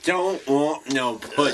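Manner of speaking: put-on slow, high protest; impaired-speech character. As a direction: drag out the catchphrase loudly in character. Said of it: "I had a Down syndrome character".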